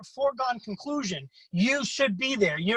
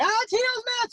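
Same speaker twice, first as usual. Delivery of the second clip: much higher, frantic voice; panicky Teddy. Retold or imitated. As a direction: squeal it like a panicking friend. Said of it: "He's like".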